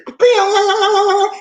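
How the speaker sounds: high, loud imitated siren; antenna alarm. Antenna going off at somebody's game, loud high alarm noise. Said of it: "I'll be like, the antenna say".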